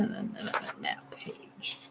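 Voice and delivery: very soft, barely voiced; self-directed murmur. Real, not acted. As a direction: think aloud under your breath. Muttering to self while searching.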